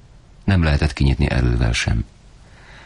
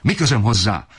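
much higher, bitter shouting rant; furious yelling father; 11 semitones higher than usual. A narrator's reading. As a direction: let the father explode at the crying family. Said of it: "kiabált annál jobban". (magasabb, keserű dühös kiabálás)

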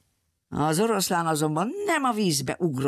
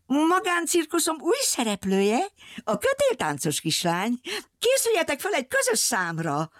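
bossy circus lady; 11 semitones higher than usual. A narrator's reading. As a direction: present the new act like a grand ringmistress. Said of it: "a fekete kontyos nő".

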